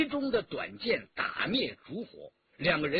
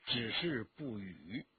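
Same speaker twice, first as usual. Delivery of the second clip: plain reading voice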